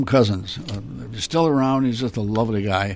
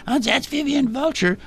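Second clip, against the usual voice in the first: very high falsetto puppet; Vivian Vulture. Shown as a demonstration. Puppeteer offers second voice choice, high falsetto. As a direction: pipe it up in a squeaky puppet falsetto. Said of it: "Here's the other choice".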